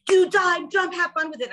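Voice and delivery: imitating a cheery host urging players; hyped Jeff. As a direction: fire the players up like a game show host. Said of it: "because Jeff was like".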